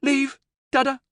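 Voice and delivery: put-on high, clear little child voice; small girl's clear voice. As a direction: speak plainly, like a small child warning. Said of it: "she said in a clear voice".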